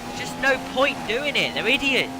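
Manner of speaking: fed-up angry rant at the game; frustrated outburst. Real, not acted. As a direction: vent at the AI ramming.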